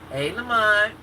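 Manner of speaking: ordinary talk, nothing marked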